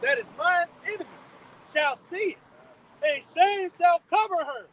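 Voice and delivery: slow, solemn Bible reading; scripture reading. Acted. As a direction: read it like a preacher from the pulpit. Reads scripture aloud solemnly.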